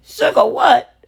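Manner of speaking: shocked. Retold or imitated. Mother reacting to her toddler's odd word, shocked high question. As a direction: squeak out the question in disbelief.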